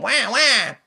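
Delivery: piercing, loud fake baby wail; hammed-up high baby crying. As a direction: bawl a put-on "wah" like an infant. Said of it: "always fake all the time".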